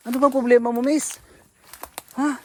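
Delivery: loud pleading question; calling out. Real, not acted. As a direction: shout across to her. Shouting to angry woman.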